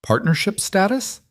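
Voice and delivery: sharp rising disbelief; outraged question, pitched high; incredulous. Acted. Dominic outraged, repeating terms.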